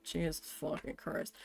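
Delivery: low, very soft oath; muttered dismay. Retold or imitated. Worker overhearing the manager yelling, muttered dismay. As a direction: curse under the breath, low and soft.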